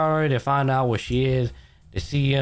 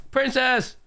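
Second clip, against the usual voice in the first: excited spirit. Retold or imitated. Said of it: "the spirit's like".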